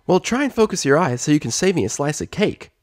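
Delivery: put-on high, boyish little dragon; Spike voice. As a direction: voice a cheeky young dragon.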